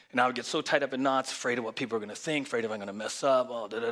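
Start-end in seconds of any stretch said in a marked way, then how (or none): none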